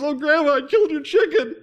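high, loud, tearful wail; tearful confession. Acted. Boy confessing to Grandma, bursting into tears. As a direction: blurt it out crying like a little boy. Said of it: "he bursts into tears and says".

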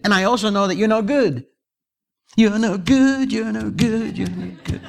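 high, emphatic pulpit proclamation; full-force preaching. Real, not acted. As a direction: drive each point home to the congregation.

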